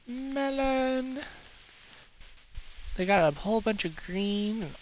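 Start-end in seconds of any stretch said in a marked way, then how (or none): 0.1-1.2 high, held note at normal volume; playful high call. Pretend. Player calling for melons to eat, playful high call. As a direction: stretch it out high and even.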